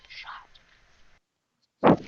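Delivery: long, voiceless whisper of one word; mock-traumatized whisper; haunted whisper. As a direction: whisper it slowly, as if shaken by the word.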